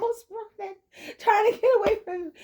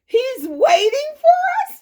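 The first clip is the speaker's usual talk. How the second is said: surprised; 6 semitones higher, 7 dB louder, slower than usual. Real, not acted. Disbelief on hearing news.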